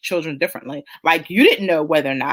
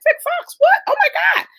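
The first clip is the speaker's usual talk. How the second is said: much higher, startled gasping question; shocked disbelief; 3 dB louder than usual. Retold or imitated. Hearing rumours that Rick Fox died, shocked disbelief. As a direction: hear the name and reel in disbelief. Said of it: "And I was like".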